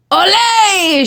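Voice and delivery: high, drawn-out hurrah; shouted cheer. A narrator's reading. Amigos greeting his perfect landing, shouted cheer. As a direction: cheer it like a welcoming gang. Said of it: "shouted his chimichangos amigos".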